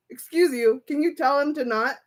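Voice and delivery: usual speaking voice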